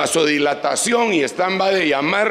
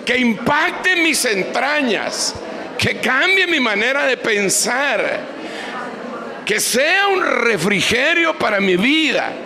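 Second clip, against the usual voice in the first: fervent prayer; 4 semitones higher than usual. Acted. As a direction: cry out to God with full force. Leads fervent prayer aloud.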